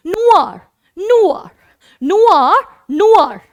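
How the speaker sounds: नुआर said slowly as French noir; teacher drilling French noir. Shown as a demonstration. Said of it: "नुआर का मतलब हो गया black".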